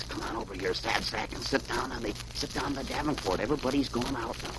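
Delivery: hushed, intimate invitation; soft coaxing girlfriend. A narrator's reading. Girlfriend invites Sad Sack onto the Davenport, softly.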